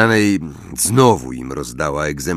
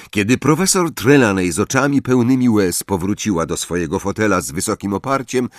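usual speaking voice

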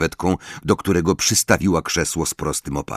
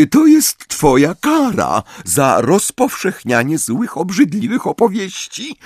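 high, sugary, mocking reproach; smug sweet teacher scolding; Umbridge voice; 7 semitones higher, 4 dB louder than usual. A narrator's reading. Umbridge lecturing Harry.

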